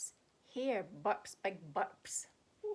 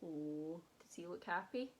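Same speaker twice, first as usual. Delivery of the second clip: lively, sing-song picture book read; reading to children; 5 dB louder than usual. A narrator's reading. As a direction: read it like a bedtime story for kids. Reads picture book aloud.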